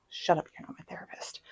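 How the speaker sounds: hushed self-directed whisper; breathy whisper to herself; whispered aside. Real, not acted. Whispering back at her own inner voice.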